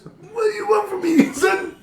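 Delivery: very high, fast, laughing mom voice; shrill mock mom. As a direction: squeal it like a giddy cartoon mother.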